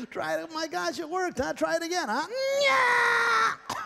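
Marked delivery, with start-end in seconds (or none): 2.6-3.8 puppet lady voice. Acted. Ventriloquist voices volunteer lady puppet.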